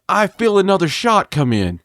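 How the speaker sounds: ordinary talk, nothing marked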